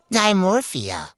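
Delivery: ordinary delivery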